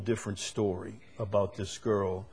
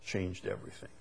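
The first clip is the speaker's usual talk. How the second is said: hushed; 9 dB quieter than usual. Real, not acted. Preacher drops for solemn climax.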